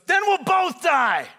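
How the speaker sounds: high, slow, defiant shout; angry yelling. Acted. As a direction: roar back in defiance. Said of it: "Harrison Ford is so angry".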